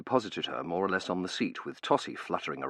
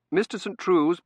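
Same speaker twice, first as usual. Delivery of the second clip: high, loud, urgent orders; butler taking charge; 12 semitones higher, 9 dB louder than usual. Acted. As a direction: give brisk orders around the patient.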